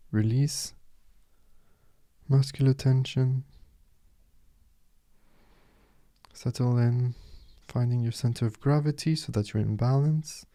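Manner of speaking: soft, unhurried meditation guide, with long pauses; softly spoken guide leaving space between phrases; guided meditation. Acted. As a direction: settle the listener gently into stillness.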